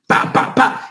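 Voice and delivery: "pa" soplado y sin voz; golpecitos imitados. Shown as a demonstration. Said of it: "imita los golpes de percusión". (pa pa pa, imitación de la percusión)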